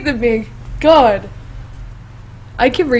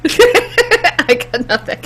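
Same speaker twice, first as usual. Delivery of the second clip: laughing; 3 semitones higher, much slower than usual. Real, not acted. Giving up after flubs.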